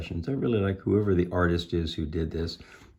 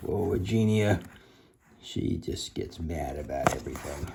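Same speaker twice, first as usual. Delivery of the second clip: ordinary delivery, nothing marked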